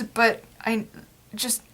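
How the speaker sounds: normal talking voice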